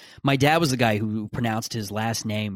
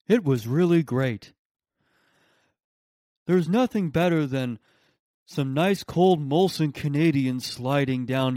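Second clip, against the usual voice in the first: slow, laid-back party-bro voice; twenty-five-year-old bro; slower than usual. Pretend. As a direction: drawl it like a chill college guy. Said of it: "I would give him that voice".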